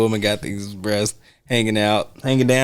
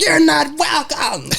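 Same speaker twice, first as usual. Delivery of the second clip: pitched way up, bratty comeback; high sassy kid. Retold or imitated. Kid sassing the motel manager, high and bratty. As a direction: snap it back like a cheeky little girl. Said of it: "And she's like".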